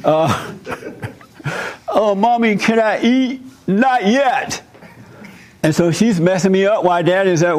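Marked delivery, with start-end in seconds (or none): 1.9-3.4 put-on high little child asking. Small child asks impatient mother for food, high and pleading. 3.7-4.6 high, curt brush-off; mother dismisses the child, pitched up; impatient mother. Retold or imitated. Impatient mother to hungry child, high and curt.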